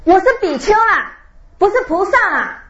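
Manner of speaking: high, loud indignant yell; retold yell. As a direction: shout back the correction, offended. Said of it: "她很大声对我吼一下".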